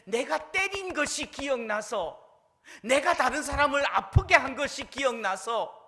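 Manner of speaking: fervent preaching. Real, not acted. Pastor urging the congregation, preaching at full force. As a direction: drive the point home to the congregation.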